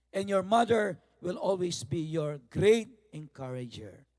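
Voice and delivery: usual speaking voice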